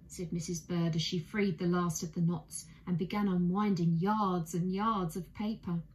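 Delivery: plain reading voice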